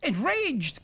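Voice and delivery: a mild loud warning cry; cry of alarm. Acted. Another tribesman adding a warning, loud cry. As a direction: call out the danger. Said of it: "cried another".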